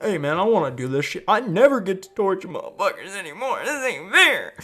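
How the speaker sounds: loud, high tantrum voice; whining henchman. Acted. Henchman whines to join torture.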